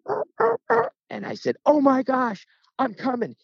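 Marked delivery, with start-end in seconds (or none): 0.1-0.9 high-pitched mimicked turkey yelps; imitated turkey call. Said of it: "he turned and went". 1.7-3.3 high-pitched, thrilled outburst; excited exclamation. Retold or imitated. Retold thrill at hearing turkey call.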